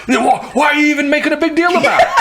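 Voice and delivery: high, worked-up retold yell with laughter; mock a heated supervisor, pitch way up; imitated yelling. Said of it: "starts yelling".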